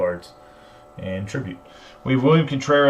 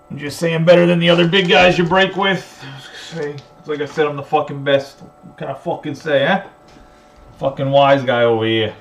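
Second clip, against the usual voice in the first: mock New York wise guy, louder and higher; New York accent; 5 semitones higher, 6 dB louder than usual. Breaker ribbing buyers like a movie mobster, New York accent. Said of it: "that shitty New York accent".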